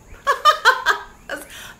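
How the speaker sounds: loud high-pitched burst of laughter. Real, not acted. Reader laughing at the ex card, loud high laugh.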